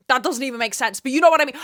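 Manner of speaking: loud, high exasperated shout; shouting rant. Real, not acted. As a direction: yell it out in frustration. Peak of rant.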